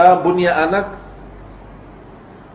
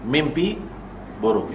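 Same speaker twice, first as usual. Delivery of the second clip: mimpi buruk repeated low and much softer; quiet repeat for weight; soft echo; 4 semitones lower, 9 dB quieter than usual. Shown as a demonstration. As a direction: let the echo of mimpi buruk drop away.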